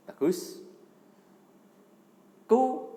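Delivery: usual speaking voice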